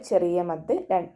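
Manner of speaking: normal talking voice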